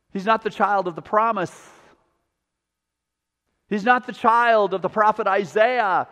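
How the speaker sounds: full-voiced sermon delivery, rising and falling; preaching. Pastor to his congregation about the ten lepers, preaching.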